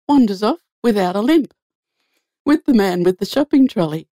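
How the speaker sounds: ordinary talk, nothing marked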